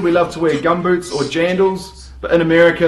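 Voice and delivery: ordinary talk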